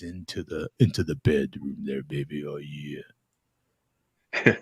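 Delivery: mock bass-speaker come-on, dropping to the bottom; deep seductive voice. Imitating lesbians recruiting ladies into bed, deep seductive voice. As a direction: sink very low, a mock seducer. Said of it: "very much deeper speaker".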